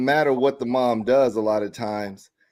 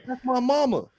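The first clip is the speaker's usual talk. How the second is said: a touch higher, stubborn; big man defending mom; 10 semitones higher than usual. Retold or imitated. Big man standing up for his mother, mildly high and stubborn. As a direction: insist it's your mother. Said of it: "Yeah, I don't care".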